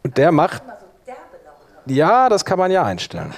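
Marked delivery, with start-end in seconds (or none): none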